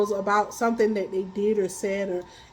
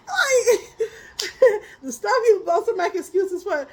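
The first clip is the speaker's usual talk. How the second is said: laughing; 7 semitones higher than usual. Real, not acted. Still laughing at herself.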